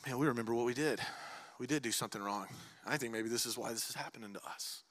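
soft, low and guilty, almost to themselves; hushed confession. Acted. Brothers in prison quietly admitting their wrong, hushed. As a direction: murmur it like men haunted by guilt. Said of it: "they go".

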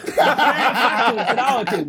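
slow, laughing. Real, not acted. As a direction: draw it out laughing. Laughs at his own point.

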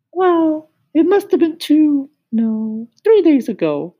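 very high, sing-song cricket asking who's there; high musical cricket. Acted. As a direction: squeak it up high like a tiny cricket. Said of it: "He had a high musical voice".

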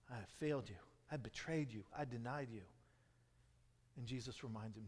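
soft, low, gentle words; tender reassurance. Acted. Jesus restoring ashamed Peter, soft and tender. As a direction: speak like forgiving a friend.

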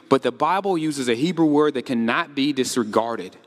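usual speaking voice

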